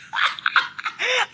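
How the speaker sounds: high airy giggle; high breathy laugh. Real, not acted. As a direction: burst into a high unvoiced laugh. Laugh alone.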